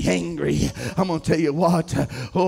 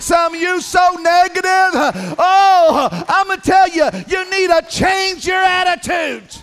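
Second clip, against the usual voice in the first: loud, rising preacher's shout; full-force sermon cadence; fiery preaching; 7 semitones higher, 4 dB louder than usual. Acted. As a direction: preach it hard to the congregation.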